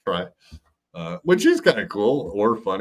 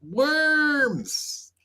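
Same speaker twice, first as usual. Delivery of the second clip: mocking mispronunciation; 3 dB quieter than usual. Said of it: "You don't know how to pronounce words?".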